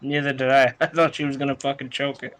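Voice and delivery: ordinary talk, nothing marked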